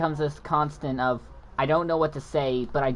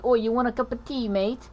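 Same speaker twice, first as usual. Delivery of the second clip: higher-pitched mock British jab; mock British accent; 6 semitones higher than usual. Kid taunting a stutterer about tea, mock British accent. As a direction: imitate a kid putting on British. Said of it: "making fun of British people".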